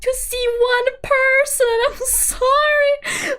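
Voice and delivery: high, whiny, half-crying apology; hammed-up teary whimper; mock whimper. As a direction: beg forgiveness in a fake-tearful whine. Retelling panicked apology to seller.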